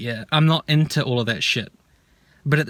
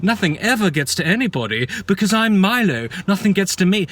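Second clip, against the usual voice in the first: higher, swooping mock bravado; mocking sarcasm; 5 semitones higher than usual. Mocking Milo as untouchable by anything, heavy sarcasm. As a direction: scoff it out in a singsong, higher voice.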